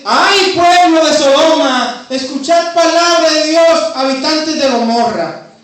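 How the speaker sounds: loud, high, thundering prophet's cry; prophet's denunciation. Retold or imitated. Isaiah crying out against Sodoma and Gomorra, loud and high. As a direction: thunder the prophet's warning at the crowd.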